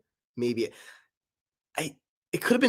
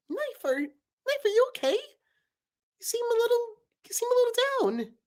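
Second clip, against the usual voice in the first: Paper's voice. Acted. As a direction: ask gently in a squeaky voice. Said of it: "Paper and Knife talking".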